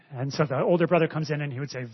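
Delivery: ordinary talk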